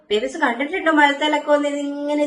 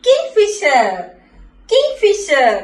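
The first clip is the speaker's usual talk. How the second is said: higher, louder king Fisher with weight on king; emphatic model word; clear teaching word; 5 semitones higher, 4 dB louder, much slower than usual. Shown as a demonstration. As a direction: say king Fisher clearly, pitched up, for kids to copy. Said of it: "babies പറഞ്ഞേ".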